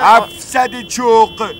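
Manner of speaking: slow, loud, weighty price; irritated, drawn-out demand; curt fare demand. Acted. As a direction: spell out the fare slowly and loudly. Angry driver demands the fare.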